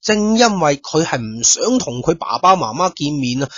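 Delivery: usual speaking voice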